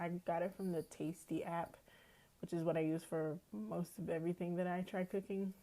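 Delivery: normal talking voice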